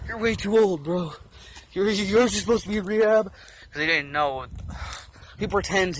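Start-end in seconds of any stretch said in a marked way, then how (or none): 0.1-3.3 higher, put-on voice of a brushed-off director; a director's higher, scornful put-on voice; mock dismissive director. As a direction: talk down to him like a washed-up has-been. He's like.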